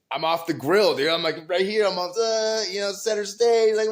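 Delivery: high, loud, bragging excitement; jolly and pumped up. Retold or imitated. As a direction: re-enact being thrilled to be center stage. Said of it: "You're in a jolly mood".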